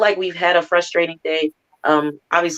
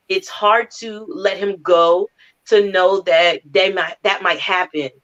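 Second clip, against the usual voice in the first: ordinary talk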